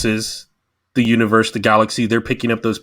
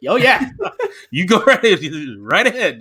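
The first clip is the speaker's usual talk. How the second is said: high, laughing mock go-ahead; eager approval; 7 semitones higher than usual. Producers cheerfully letting the actor keep his line, eager approval. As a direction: imitate delighted bosses giving in, voice pitched up.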